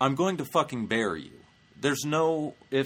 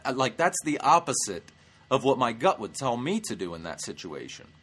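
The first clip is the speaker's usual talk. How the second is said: usual speaking voice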